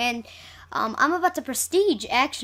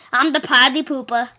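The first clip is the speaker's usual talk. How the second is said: ordinary talk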